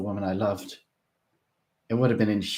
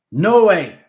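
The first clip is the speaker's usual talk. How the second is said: much higher, louder defiant shout; 11 semitones higher, 15 dB louder than usual. A narrator's reading. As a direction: fling the refusal out. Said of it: "Vansha shouted immediately".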